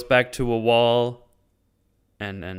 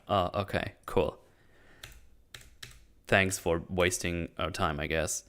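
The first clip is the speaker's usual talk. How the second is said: sarcastic; 5 semitones lower, 8 dB quieter than usual. Retold or imitated. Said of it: "It's like".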